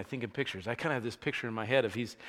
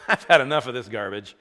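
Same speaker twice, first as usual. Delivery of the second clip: laughing mock exasperation; 2 semitones lower, 10 dB louder than usual. Jesus fed up at the cliff.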